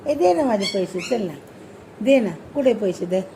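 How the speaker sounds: ordinary delivery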